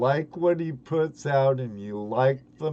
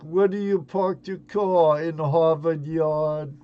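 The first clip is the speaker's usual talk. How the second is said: a light, raised mock Boston voice asking "Where do you park your car?"; mock Boston accent; 4 semitones higher than usual. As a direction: tease it like a tourist mimicking Boston. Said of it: "they always wanna hear the thing like".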